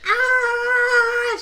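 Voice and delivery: very high, loud held "aah"; imitated scream. Retelling how she started screaming again, mock scream. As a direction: shriek it out as an impression of her. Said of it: "She starts screaming again".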